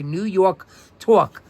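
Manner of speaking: "New York Talk" slowly in a New York accent, "Yawk Tawk"; drawn-out New York "Tawk". Shown as a demonstration. Teacher to class, demonstrating the New York accent. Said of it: "T A W K".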